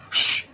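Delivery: a big theatrical shh; storybook shush, loud and drawn out; loud hushing shh. Acted. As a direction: hush the audience loudly. Frog book refrain, hushing.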